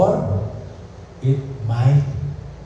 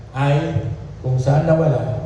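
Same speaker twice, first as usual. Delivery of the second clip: ordinary delivery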